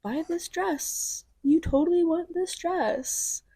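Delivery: slow, high, pushy sales pitch; mock a pushy saleswoman selling a dress; pushy salesperson voice. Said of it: "I was like".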